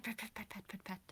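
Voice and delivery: soft murmured pet pet pet; gentle pets. Acted. Snuggy softly murmuring while petting the bug. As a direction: murmur each pat softly.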